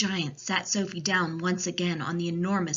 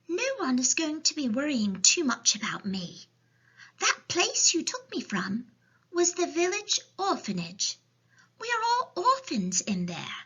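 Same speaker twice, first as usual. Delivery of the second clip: put-on high little girl voice; child's storybook voice; Sophie; 9 semitones higher than usual. A narrator's reading. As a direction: explain as a young orphan, high-pitched. Sophie explains the orphanage.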